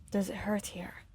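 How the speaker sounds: soft whispered question; tender whisper, barely voiced; low whisper. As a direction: whisper it gently to the injured girl. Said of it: "his voice low".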